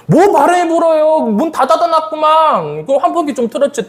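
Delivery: high-pitched, disbelieving comeback; retold irritated retort. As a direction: throw the excuse back, exasperated. Acted irritated retort to the excuse.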